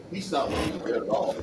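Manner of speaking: ordinary talk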